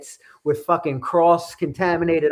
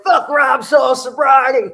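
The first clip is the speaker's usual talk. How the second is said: slow, loud, higher hammed-up wrestler shout; Stone Cold promo impression; 9 semitones higher, 7 dB louder than usual. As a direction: bellow it like a beer-swigging wrestler. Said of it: "cutting a Stone Cold Steve Austin type of promo".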